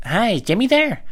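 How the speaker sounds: high, loud phone voice; put-on caller voice. Caller asks for brother Jimmy, high put-on voice.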